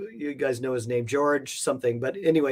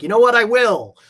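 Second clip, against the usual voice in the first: retold, pitched up high and loud; playful, louder burst of "I will"; excited resolve; 9 semitones higher, 9 dB louder than usual. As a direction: declare it like a sudden triumphant decision. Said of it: "and I was like".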